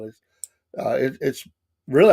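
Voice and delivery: normal talking voice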